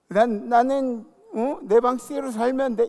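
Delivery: stubborn self-talk. Retold or imitated. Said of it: "이게 제일 문제라고".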